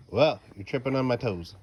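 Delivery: normal talking voice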